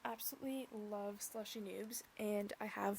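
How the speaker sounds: whisper. Real, not acted. Confesses her fandom in a whisper.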